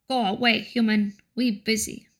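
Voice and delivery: ordinary talk, nothing marked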